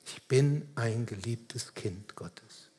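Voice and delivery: slow, hushed near-whisper; andächtig leise. Real, not acted. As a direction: let it sink in softly and slowly. Zuspruch, soll in die Seele fließen. (andächtig, langsam, fast geflüstert)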